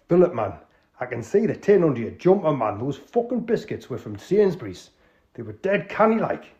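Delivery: high, loud Geordie outrage; Geordie accent. Acted. Tony demanding where the biscuits went, Geordie. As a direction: Geordie host fuming over the tin. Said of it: "an authentic, uh, Northerner".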